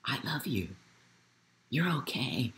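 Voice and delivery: put-on soft, hushed comfort to a hurting child; tender reassurance. A parent softly reassuring their child, tender and hushed. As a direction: say it gently, like holding them.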